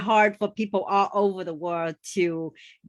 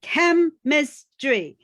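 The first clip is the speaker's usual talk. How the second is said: "Chemistry" stressed on CHEM, drawn out very slowly, a bit louder; slow teacherly stress on the first syllable; corrected stress; 4 semitones higher, 4 dB louder, much slower than usual. Retold or imitated. College friend correcting the student's stress. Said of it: "she's like".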